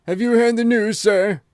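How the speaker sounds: high, loud and gasping, the butler bursting in; breathless butler. A narrator's reading. Butler rushing in at breakfast, breathless and alarmed. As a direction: blurt it out, shaken out of all propriety. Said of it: "he gasped".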